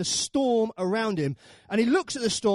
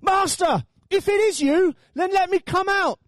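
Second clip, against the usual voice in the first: high, raised calling voice; loud acted plea from a boat; 9 semitones higher, 5 dB louder than usual. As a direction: call across the waves to your master. Peter calls to Jesus over storm.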